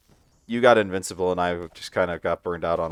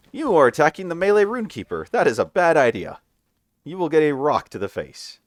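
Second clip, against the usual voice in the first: booming boss voice; 8 semitones higher, 5 dB louder than usual. Acted. Boss warning voiced during play.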